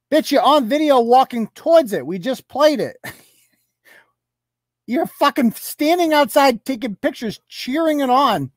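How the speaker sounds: high, heated retort; scornful rebuttal. Real, not acted. Blogger contradicts her denial from the video, heated scorn. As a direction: throw the evidence back at them, exasperated.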